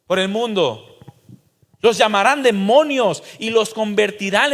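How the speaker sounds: loud, high, impassioned sermon; a preacher thundering warnings at the congregation; fiery preaching. Acted. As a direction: preach it out at full volume. Preaching at full force.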